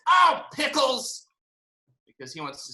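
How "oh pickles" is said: high, drawn-out cry of dismay; grumbling boy's protest. Acted. Boy who wants to stay up late, high frustrated exclamation. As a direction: whine it out like a thwarted kid. Boy unhappy about bedtime.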